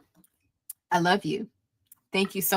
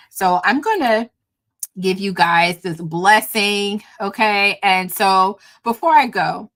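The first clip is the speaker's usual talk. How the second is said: ordinary delivery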